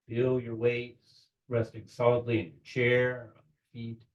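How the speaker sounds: unhurried visualization with long pauses between phrases; measured meditation-leader voice; guided meditation. Real, not acted. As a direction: guide them gently, phrase by phrase.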